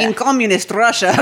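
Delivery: hint of a Russian accent; lightly mock Russian accent. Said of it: "I can't do a Russian accent".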